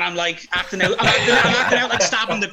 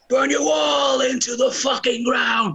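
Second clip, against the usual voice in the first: shouted lyric; 7 semitones higher, slower than usual. Retold or imitated. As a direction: belt it out slowly like on stage. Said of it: "I just change it a bit. So it's like".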